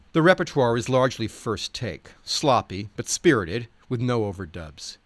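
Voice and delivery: usual speaking voice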